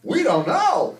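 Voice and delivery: high, loud holler; grandpa character. Pretend. Grandpa answering about the airtime, loud high holler. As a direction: shout it high as a clueless grandpa. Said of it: "In your character voices".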